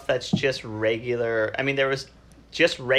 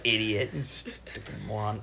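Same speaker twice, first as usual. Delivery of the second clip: ordinary talk, nothing marked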